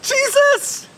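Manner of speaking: high, fearful call across water. Acted. Fisherman calling to Jesus over the waves, frightened call. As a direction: call out high to someone far off. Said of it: "Peter called out".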